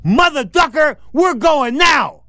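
very high shouted command, drawn out at the end; furious retold shout. Host banging fist on table at fighter, shouting. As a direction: explode and order them out, loudly. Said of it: "I bang my fist on the table".

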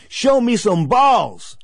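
slow, high, loud mock shout; retold shout. Host imitating the father shouting at his son in the corner. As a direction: bellow it like a harsh corner father. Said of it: "his father looks at his kid and says".

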